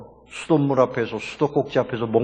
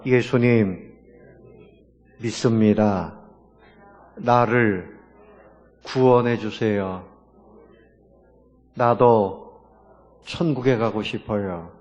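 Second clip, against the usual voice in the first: slow, lower, phrase by phrase with long pauses; repeat-after-me prayer; 4 semitones lower, slower than usual. Shown as a demonstration. Pastor leads congregation in a confession of faith, slow repeat-after-me prayer. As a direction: lead them word by word, leaving room to echo.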